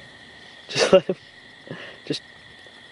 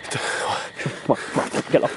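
urgent whisper; 6 semitones lower, 8 dB quieter, slower than usual. Acted. Urging the freed friend.

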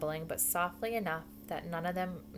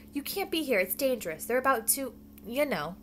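raised, higher warning; urgent hiss; 6 semitones higher, 4 dB louder than usual. A narrator's reading. Bongo warning Flash of the danger, urgent hiss. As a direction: warn with hissed urgency. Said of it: "she hissed".

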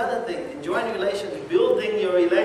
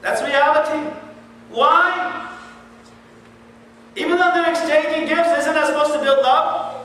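loud, high preaching at full force; fiery preaching; 11 semitones higher, 8 dB louder than usual. Acted. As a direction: hammer it home to the congregation.

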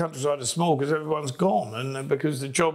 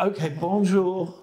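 slow, reluctant "Okay, bonjour."; grudging bonjour; 3 semitones higher, slower than usual. Retold or imitated. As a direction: drag out a grudging "bonjour" as if giving in. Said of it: "And you go".

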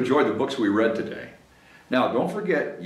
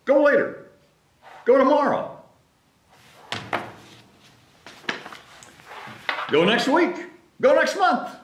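high, desperate bargaining; begging Gerald; 11 semitones higher than usual. Acted. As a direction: plead for more time, voice up high.

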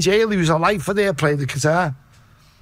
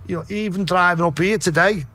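usual speaking voice

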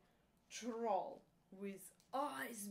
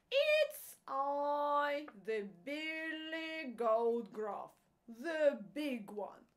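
hoarse goat voice; 4 semitones higher, 7 dB louder, slower than usual. Acted. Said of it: "an ugly hoarse voice".

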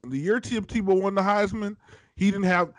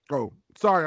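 ordinary delivery

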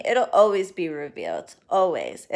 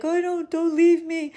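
high, put-on whimper of a needy client; mock client begging the reader not to leave, high and whiny; mock clingy plea; 9 semitones higher than usual. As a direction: play the panicked client begging, pitched way up. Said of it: "panic and be like".

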